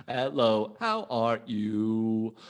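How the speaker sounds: slow, measured robot echo; imitated robot voice. Teacher repeating a pupil's greeting, slow robot voice. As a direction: copy the robot, stretching each word evenly. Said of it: "did you wanna do a robot voice as well?".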